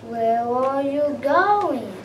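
lightly voiced cunning fox. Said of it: "said the fox".

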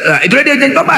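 ordinary talk, nothing marked

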